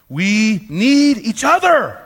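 loud, high and slow; a slow, loud call to the room; preaching climax. Acted. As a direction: lean hard on every word.

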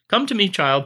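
a hammed-up cult leader calling his follower; beckoning villain. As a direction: lure her off the cliff gently. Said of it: "It's like".

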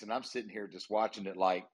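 usual speaking voice

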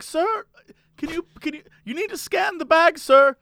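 high, insistent cashier voice; lightly voiced pushy store clerk; older cashier lady. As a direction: pitch it up as the fussy cashier. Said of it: "has a German accent".

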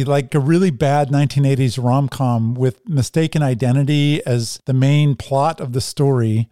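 usual speaking voice

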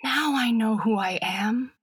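understated wondering exclamation, read aloud; exclaimed in wonder. A narrator's reading. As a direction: let the discovery dawn with mild awe. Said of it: "the doll exclaimed in wonder".

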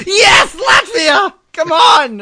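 high, loud cheer for Latvia; laughing cheer for Latvia. Real, not acted. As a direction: yell it out like a fan celebrating.

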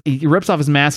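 ordinary talk, nothing marked

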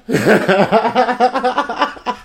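rapid laughing fit; real cackling laughter. Real, not acted. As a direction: crack up right after singing. Cracks up at own song.

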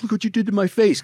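high-pitched mock protest; whining complaint. Beaten man to his attacker, high acted complaint.

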